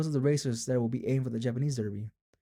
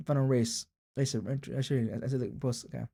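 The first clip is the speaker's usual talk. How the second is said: ordinary talk, nothing marked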